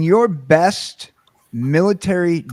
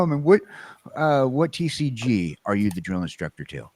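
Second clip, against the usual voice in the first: ordinary talk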